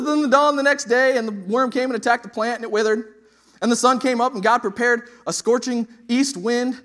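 loud, high preaching voice, storytelling Jonah with fire; preaching at full force. Acted. Preacher retelling Jonah to the church, loud and lively.

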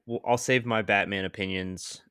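normal talking voice

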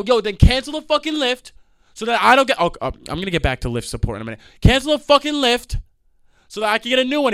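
telling driver to cancel the ride; angry demand. Retold or imitated. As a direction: demand it angrily.

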